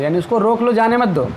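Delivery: ordinary talk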